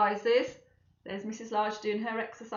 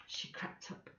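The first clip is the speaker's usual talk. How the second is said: unvoiced narrator whisper; 16 dB quieter than usual. A narrator's reading. Narrator whispering as she sneaks down. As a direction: hush it like creeping downstairs.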